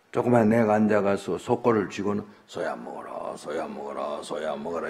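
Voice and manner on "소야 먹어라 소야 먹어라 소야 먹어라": put-on low, soft monotone repetition, like a child absorbed in feeding; child's quiet repeated coaxing. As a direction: keep urging softly in a low, even murmur. Child chanting at dead ox.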